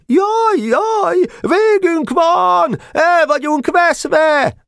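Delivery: very high, drawn-out wail of defeat; mock panicked cries. A narrator's reading. Boys tricking pursuers by feigning defeat, mock panicked cries. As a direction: cry doom in a stretched, high shriek, feigning panic. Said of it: "még ilyeneket is kiabáltak".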